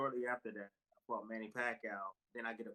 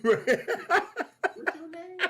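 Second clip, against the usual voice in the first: much higher and louder, slow, laughing through it; laughing question; 15 dB louder, much slower than usual. Retold or imitated. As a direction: ask his name while cracking up. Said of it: "I had to ask him".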